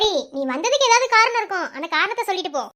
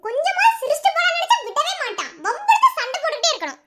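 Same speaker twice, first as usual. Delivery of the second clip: high-pitched fed-up grumbling; exasperated complaint; 7 semitones higher than usual. Acted. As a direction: vent your irritation, pitch swinging up. Complains he always picks fights. (சலிப்பும் எரிச்சலும்)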